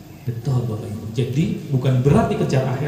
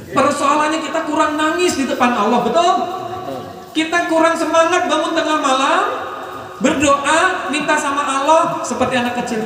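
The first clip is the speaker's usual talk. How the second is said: fervent preaching; 12 semitones higher than usual. Acted. Preaching at full force.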